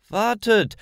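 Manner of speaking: a woman calling after Lisa and grandma. A narrator's reading. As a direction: stop them with a sudden call. Woman calls after them.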